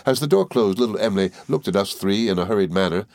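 plain reading voice

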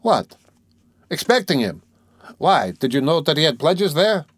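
high-pitched burst of startled questions; astonished cry. A narrator's reading. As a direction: exclaim in disbelief, pitch jumping up. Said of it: "cried Razumihin".

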